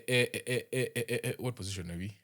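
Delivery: normal talking voice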